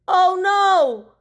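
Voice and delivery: high, loud alarmed outcry; alarmed cry. Acted. Boy as tuna hits his dory, high alarmed cry. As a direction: cry out as the boat tips.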